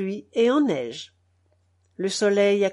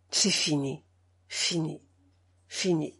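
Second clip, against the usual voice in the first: low, soft, fading repeat of despair; despairing bells; 6 semitones lower, 7 dB quieter than usual. A narrator's reading. The bells tolling their despair, low and fading. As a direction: let each fini sink lower and softer. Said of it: "les cloches parlent de tout avec un désespoir déchirant".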